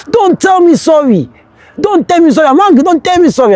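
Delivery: shrill-free hammed-up fury: push the apology away; imitating angry friend. Friend refusing an apology in camp, mock furious. Confidence's angry quote retold.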